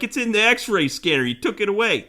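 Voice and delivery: high, exasperated complaint; indignant protest. Retold or imitated. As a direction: insist in disbelief. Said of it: "I'm like".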